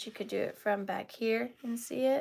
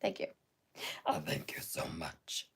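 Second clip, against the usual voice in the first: deep goofy voice; 12 dB quieter than usual. Pretend. Goofy thank-you to buyer.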